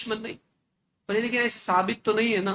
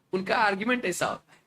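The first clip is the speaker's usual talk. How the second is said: ordinary talk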